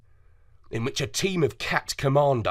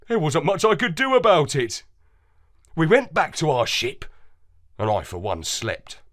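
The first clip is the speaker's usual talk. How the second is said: usual speaking voice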